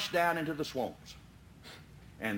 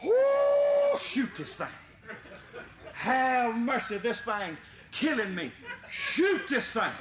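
high pleading yell, slowed; desperate holler; 7 semitones higher, slower than usual. Retold or imitated. John pleading with Barron to shoot the lynx. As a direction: beg them to shoot. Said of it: "he kept hollering".

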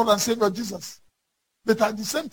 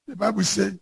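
ordinary delivery

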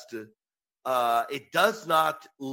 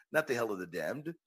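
ordinary talk, nothing marked

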